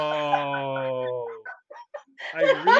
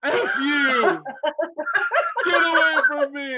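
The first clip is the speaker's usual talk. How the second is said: wife yelling; 8 semitones higher, 6 dB louder than usual. Retold or imitated. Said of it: "And I'm like".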